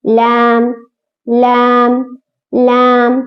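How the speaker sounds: लाम said thin and light; teacher sounds लाम light, not heavy; light Laam. Shown as a demonstration. Teacher shows students a thin light लाम. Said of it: "एकदम पतले से पढ़ना है".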